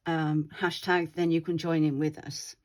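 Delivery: usual speaking voice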